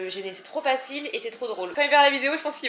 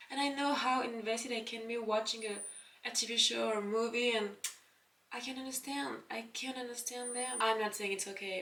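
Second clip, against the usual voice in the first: mature interviewed actress; 6 dB quieter than usual. Pretend. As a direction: play a star gently forgiving the haters. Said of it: "je suis tellement mature que je suis passée à autre chose".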